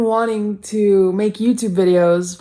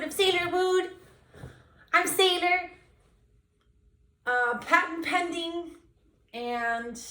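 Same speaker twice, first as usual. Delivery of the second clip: high, bright-eyed host sign-off; cheesy host voice; mock show host; 9 semitones higher, 8 dB quieter than usual. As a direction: announce it like a TV host. Hosting an announcer sign-off.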